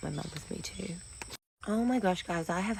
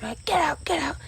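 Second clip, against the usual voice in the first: high, loud order; angry guard; 11 semitones higher, 6 dB louder than usual. Retold or imitated. Guard shouting at the shirtless man, high and loud. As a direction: throw him out.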